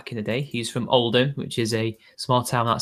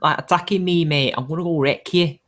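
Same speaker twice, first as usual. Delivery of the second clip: higher-pitched mock Scouse threat; Scouse accent; 4 semitones higher than usual. Shown as a demonstration. Co-host warns a mate to stop attacking, Scouse accent. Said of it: "Scouse accent".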